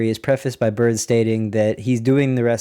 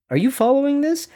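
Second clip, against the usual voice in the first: amazed; 13 semitones higher, 3 dB louder than usual. Real, not acted. Checks in with listeners, amazed.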